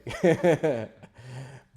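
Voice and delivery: loud amused laughter; real laugh. Real, not acted. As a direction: laugh openly at the irony. Teller laughs at the irony.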